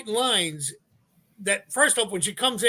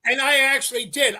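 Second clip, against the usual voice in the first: ordinary delivery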